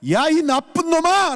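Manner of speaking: high drawn-out shout of protest; demo shout. Shown as a demonstration. Pastor demonstrates the shout a victim must give, loud and high. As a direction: yell it out slowly, high and loud. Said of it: "라고 소리를 지르라는 것입니다".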